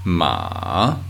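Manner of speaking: "ma" dipping low, then swinging up; falling-rising third tone. Shown as a demonstration. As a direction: say "ma" drawn out, down and back up. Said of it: "also known as falling and rising tone".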